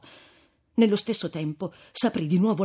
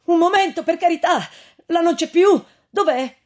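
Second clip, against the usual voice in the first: put-on loud, high pleading young man; frantic, pleading call up to a window; desperate plea; 11 semitones higher, 8 dB louder than usual. A narrator's reading. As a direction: beg her not to shut the door. Renzo pleads at the door.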